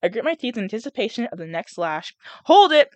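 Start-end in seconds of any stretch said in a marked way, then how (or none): 2.5-2.9 loud, high sharp command; bark. A narrator's reading. Haymitch barking at the peacekeeper to halt, loud. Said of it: "a voice barks".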